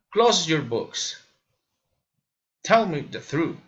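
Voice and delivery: commands dropping on "books" and "truth"; falling intonation. Shown as a demonstration. Learner demonstrating commands, falling intonation. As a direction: let "books" and "truth" fall in pitch. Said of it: "falling intonation".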